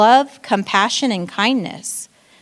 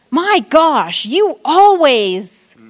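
louder, higher and slowed right down, fed up; exasperated nagging; 5 semitones higher, 6 dB louder, much slower than usual. Retold or imitated. Wife snapping at her spouse, exasperated and loud. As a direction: drag out the complaint like you've had it.

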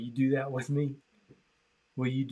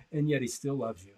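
softer, lower, tender turn; 4 semitones lower, 8 dB quieter than usual. Acted. Preacher gently tells listeners God still loves them, softly. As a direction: drop to a soft, tender promise.

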